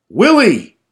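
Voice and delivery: loud high call across the yard. Retold or imitated. Son calling out to father across the lot, loud. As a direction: shout his name to the caboose.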